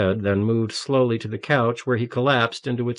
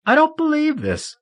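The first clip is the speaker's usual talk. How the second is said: much higher, near-wailing sob; rising sobbing disbelief; 4 dB louder than usual. A narrator's reading. Marion overwhelmed by tears, crying out in disbelief. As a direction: let it break upward into a wail. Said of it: "The strength of her sobs doubled her over".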